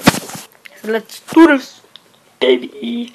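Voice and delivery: slow, loud hyped-up call; drawn-out loud hype. Pretend. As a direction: pump yourself up out loud. Hyped start of round.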